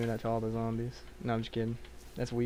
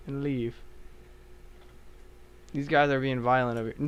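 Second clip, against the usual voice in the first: ordinary talk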